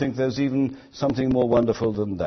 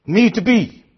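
tender promise; 6 semitones higher, 4 dB louder than usual. Acted. Preacher voicing God's tender promise.

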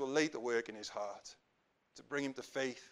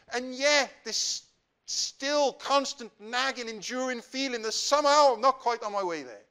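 raised, emphatic lament, stretched out slowly; frustrated plea; 6 semitones higher, 5 dB louder than usual. Acted. Young man pleads to the Lord over wasted years, frustrated. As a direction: plead with growing weariness at years of effort. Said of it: "I think it might have been this".

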